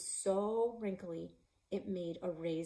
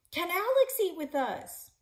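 shouted request; 11 semitones higher, 8 dB louder than usual. Acted. Kid shouting to mom.

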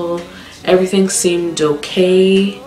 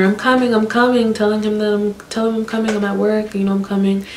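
urgent reassurance; 6 semitones higher than usual. Retold or imitated. Pleading urgently to mom on phone.

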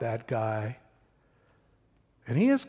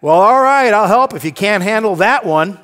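loud, high-pitched retort; shot back; 7 semitones higher, 12 dB louder than usual. Retold or imitated. Husband answering wife's hint about the dishwasher, shot back. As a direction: imitate an irritated husband firing back. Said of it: "I shot back".

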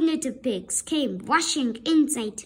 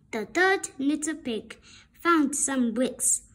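ordinary delivery, nothing marked

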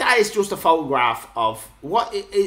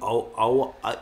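ordinary delivery

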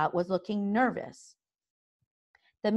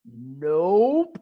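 louder cheerful nope; happily; 2 semitones lower, 4 dB louder than usual. A narrator's reading. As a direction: toss it off, pleased with yourself. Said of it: "said Stoic happily".

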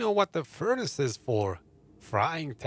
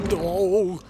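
panicked yell; 4 semitones higher, 8 dB louder than usual. Real, not acted. Caught while sneaking.

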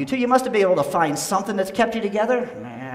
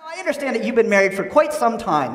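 normal talking voice

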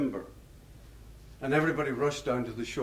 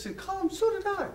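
astonished Irishman. Retold or imitated. Irishman discovering they shared a school, high and amazed. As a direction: squeal the delighted coincidence. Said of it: "getting more and more excited".

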